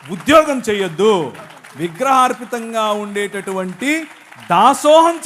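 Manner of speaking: slow, booming declaration building to a shout; forceful loud proclamation. Real, not acted. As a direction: proclaim each prohibition loudly, drawing it out. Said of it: "ఇంకా గట్టిగా చెప్పాలా".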